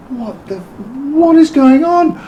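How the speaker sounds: very high, drawn-out mock disbelief; bewildered panic. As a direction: re-enact a stunned, squealed question. Acting bewildered reaction to the order.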